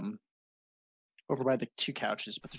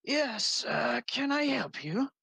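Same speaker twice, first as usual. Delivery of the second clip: put-on higher, slightly strange old wizard voice; elderly wizard; 11 semitones higher, slower than usual. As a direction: greet the visitor as an old wizard. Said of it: "and he says".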